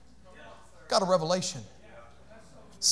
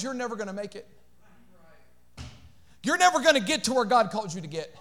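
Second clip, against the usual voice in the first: taunting voice; 4 semitones higher, 12 dB louder than usual. Acted. Preacher voicing the enemy's taunts.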